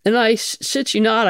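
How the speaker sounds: normal talking voice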